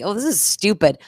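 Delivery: normal talking voice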